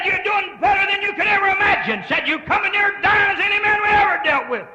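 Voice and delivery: loud, high sustained declaration; doctor's astonished reply. Retold or imitated. Preacher voicing the specialist, loud and high. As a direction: proclaim the doctor's amazement.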